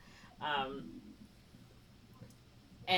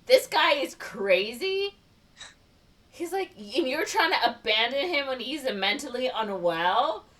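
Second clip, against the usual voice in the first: mock incredulous judge; 5 semitones higher than usual. Judge's disbelief, acted in recap.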